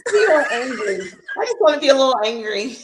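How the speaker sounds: giggling high and loud, fast; amused squeal of a listener; laughing. Real, not acted. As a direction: laugh through the words in delight. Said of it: "As I say it with a smile".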